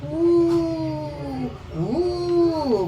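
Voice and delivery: high drawn-out dog howl; dog crying. Retold or imitated. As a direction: whimper and howl like a sad dog. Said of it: "Ganyan ang iyak ni Bantay".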